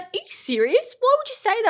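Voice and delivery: high-pitched, taken-aback imitation; mock disbelief. Imitating the man who took her joke seriously, mock disbelief. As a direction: imitate someone stunned by a joke. Retold shocked reply to a joke.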